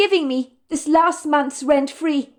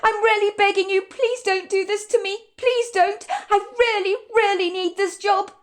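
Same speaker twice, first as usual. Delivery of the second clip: high, pleading, near tears; a waitress begging her manager, near tears; tearful pleading; 9 semitones higher than usual. Acted. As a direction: beg him not to fire you. Madison pleads for her job.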